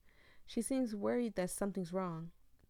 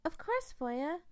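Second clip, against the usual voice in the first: put-on high, timid clown girl voice; Lala's high voice; 10 semitones higher, slower than usual. Streamer voicing Lala to the player, high timid voice. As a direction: voice Lala higher than your own.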